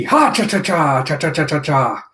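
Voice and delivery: a comic chanted laugh; mock villain cackle; cackling ha-cha-cha. Voicing a character's laugh.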